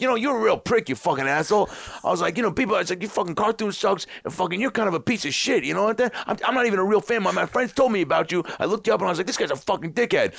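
high, mock-angry old-man rant; hammed-up cranky stranger baiting him; insulting old man. In disguise, baiting Bill Burr at the roast, high mock rant.

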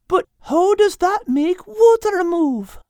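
put-on high, slow curious girl; young girl voice. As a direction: ask it like a puzzled girl. Kate's line in story.

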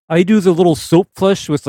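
ordinary delivery

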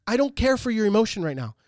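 high, loud stern dad laying down the law; father retelling scolding his daughter; stern retold yell. Dad ordering daughter to clean.

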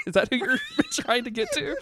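high question broken by laughs; laughing disbelief. Retold or imitated. Sibling finally guesses [name] for sister, laughing disbelief. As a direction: squeak it out through laughter.

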